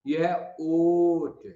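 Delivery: ये है ऑट said very slowly, ऑट drawn out; very slow teaching pronunciation; slow sound demo. Shown as a demonstration. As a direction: stretch ऑट so students hear the vowel. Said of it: "second place में ओ".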